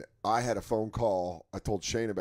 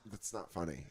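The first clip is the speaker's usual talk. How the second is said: low, quiet deadpan; unamused; 5 semitones lower, 10 dB quieter than usual. Retold or imitated. Girlfriend watching the clip in bed, quietly unamused. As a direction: say it softly and low, not amused.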